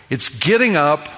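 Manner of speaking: normal talking voice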